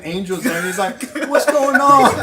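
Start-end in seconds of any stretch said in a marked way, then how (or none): 1.3-2.1 high, loud startled question; retold startled shout; alarmed friend. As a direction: blurt it out loudly, pitched way up. Startled friend quoted in story.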